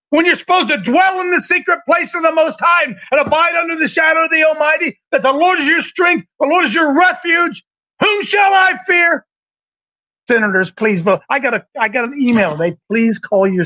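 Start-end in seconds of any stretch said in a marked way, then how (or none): 0.1-9.2 high, driving preacher's delivery; impassioned preaching. Real, not acted. Preacher challenging cowed pastors with God's refuge, impassioned high preaching. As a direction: build to a ringing rhetorical question. Preaches scripture at full force.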